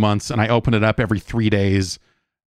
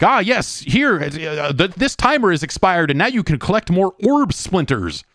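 mock game voice; 7 semitones higher than usual. Voicing the game as a character.

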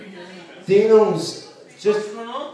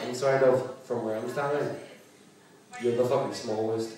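soft, low, dry put-down; deadpan comeback; 5 semitones lower, 11 dB quieter than usual. Retold or imitated. Comic answering the heckler, soft and deadpan. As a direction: drop it quietly like a knife. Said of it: "I just looked at her and went".

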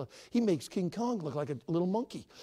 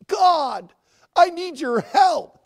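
loud, higher, slow cry to God; fervent prayer; 11 semitones higher, 13 dB louder, much slower than usual. Acted. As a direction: cry it out with full force. Same prayer, crying out.

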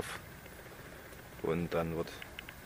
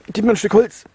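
theatralisch; 12 semitones higher, 12 dB louder than usual. Pretend. Mock plea during disinfection.

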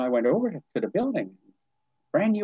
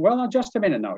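much higher "well now", pleading him to wait; placating stop; 10 semitones higher than usual. Retold or imitated. Principal calling back the departing teacher, coaxing.

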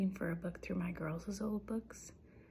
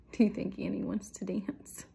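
plain reading voice

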